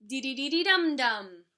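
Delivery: rhythmic marching drums; marching drum beat. A narrator's reading. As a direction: chant it like marching tom-toms. Tom-toms of marching band.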